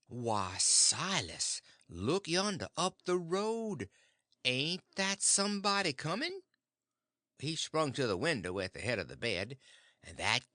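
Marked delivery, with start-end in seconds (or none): 0.1-6.4 lightly raised, feigned surprise; a hint of a teasing aunt pointing; sly aunt. Aunt tricking her husband, mild feigned surprise.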